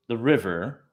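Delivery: usual speaking voice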